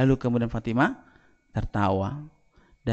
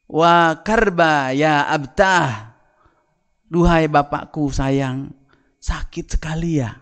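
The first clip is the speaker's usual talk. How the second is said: slow, drawn-out lament, louder on karba; lamenting verse; 3 semitones higher, 6 dB louder, slower than usual. Acted. Fatimah grieves over her dying father, slow loud lament. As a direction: mourn over a dying father, stretching each word. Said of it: "Fatimah mengucapkan syair".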